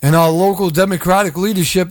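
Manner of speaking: usual speaking voice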